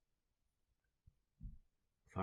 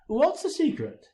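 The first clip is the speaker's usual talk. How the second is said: bright high-pitched eager question; perky young reporter's high chirp; 12 semitones higher, 5 dB louder than usual. A narrator's reading. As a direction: chirp it up, high and a little louder. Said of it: "she chirps up".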